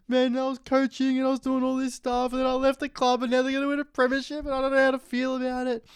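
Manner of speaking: high, put-on voice of a despairing coach; Nathan Buckley breaking down. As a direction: whine it out like a coach falling apart. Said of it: "he's, like, having a mental breakdown".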